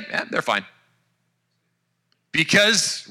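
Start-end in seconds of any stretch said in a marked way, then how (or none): 0.1-0.6 low, soft, brushing it off; a quiet dismissive aside. Pretend. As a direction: shrug it away under your breath. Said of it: "Or you might say".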